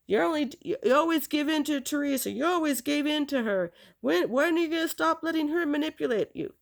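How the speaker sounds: high-pitched, exasperated nagging; scolding aunt. Retold or imitated. Aunt's scolding voiced in retelling.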